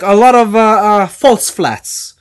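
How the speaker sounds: loud, high-pitched imitation of an Italian coach; hammed-up Italian accent; thick Italian accent. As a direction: mimic an Italian coach announcing the plan, hesitating. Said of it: "he's got this thick Italian accent".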